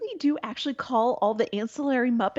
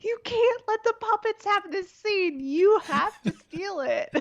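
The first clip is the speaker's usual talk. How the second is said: laughing; 11 semitones higher, slower than usual. Real, not acted. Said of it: "And I'm just like".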